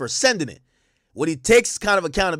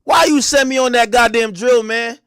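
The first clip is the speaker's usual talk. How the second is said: mock loud complaint; 8 semitones higher, 5 dB louder than usual. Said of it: "be like".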